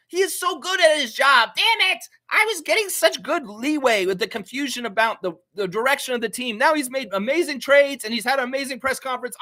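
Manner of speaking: high, loud wail of a thwarted schemer; Mister Burns. Acted. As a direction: cry out in mock despair at a rival's success. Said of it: "like Mister Burns".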